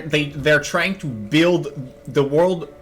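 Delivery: ordinary delivery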